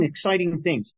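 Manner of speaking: normal talking voice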